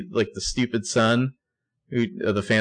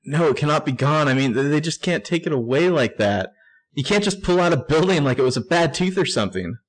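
voicing Hannah; 6 semitones higher than usual.

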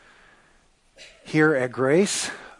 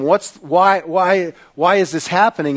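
a touch louder, frantic stammered question; frantic sailors; 4 semitones higher, 7 dB louder than usual. Acted. Sailors demanding answers from Jonah, a mildly frantic question. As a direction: press him for an answer in alarm.

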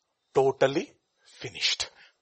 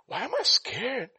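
high, drawn-out anxious question; scared wondering; slower than usual. Acted.

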